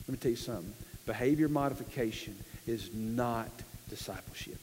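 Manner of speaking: low, hushed, deliberate correction; grave aside. Real, not acted. Preacher correcting believers about discipleship, quietly grave. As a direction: drop the voice to make the point land.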